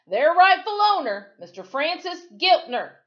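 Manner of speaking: very high, loud shout. Acted. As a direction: bellow it at the mob. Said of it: "the deputy shouted".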